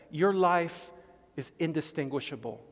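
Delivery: ordinary talk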